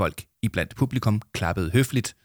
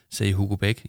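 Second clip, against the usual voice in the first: plain reading voice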